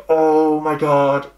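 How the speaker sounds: slow, drawn-out exasperation; exasperated. Acted. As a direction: draw out the words in mock despair. Exasperated reaction to jealous character.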